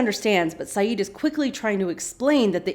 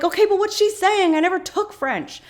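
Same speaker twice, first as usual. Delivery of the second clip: high, loud imitated fluster; hammed-up frantic voice; frantic questioning; 12 semitones higher, 5 dB louder than usual. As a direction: pitch it way up, urgently. Kate frantic over the message.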